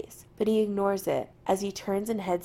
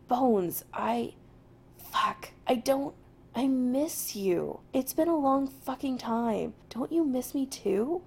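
halting, higher, earnest plea; 5 semitones higher than usual. Acted. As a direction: plead haltingly and sincerely. Jim earnestly pleading, halting.

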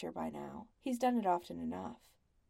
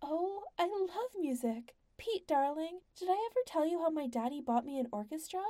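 high gushing lady, squealing with delight; spoiled wife gushing over music, high and delighted; Lady Wentz, delighted; 8 semitones higher than usual. A narrator's reading. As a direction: gush like a spoiled rich wife. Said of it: "Lady Wentz squeals with sudden delight".

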